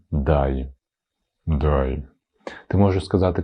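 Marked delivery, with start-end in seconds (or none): 0.1-2.0 low, malicious delivery; spiteful. Shown as a demonstration. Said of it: "ти можеш сказати злобно". (злобно)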